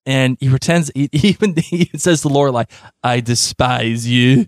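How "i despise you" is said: slow, hammed-up French accent; mock French accent, slowly; bad French accent. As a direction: do the worst French accent, drawn out. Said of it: "the worst French accent".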